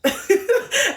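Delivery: real, high burst of giggling; cracked-up laugh. Real, not acted. Speaker amused at their former self, real cracked-up laugh. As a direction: crack up at yourself. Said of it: "it cracks me up".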